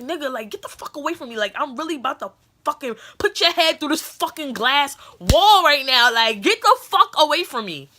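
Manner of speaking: high and building to a loud yell; threatening him, voice rising to a shout; furious telling-off. Retold or imitated.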